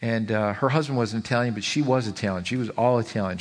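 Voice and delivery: ordinary talk, nothing marked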